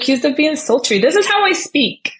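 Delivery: high-pitched, laughing indignation; mock-outraged, pitch climbing high; amused protest. Real, not acted. Amused protest at being called sultry.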